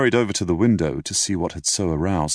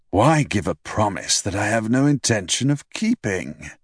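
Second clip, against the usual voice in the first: put-on dry, wry elderly doctor; lightly voiced ailing old man; old father voice; 3 semitones higher than usual. A narrator's reading.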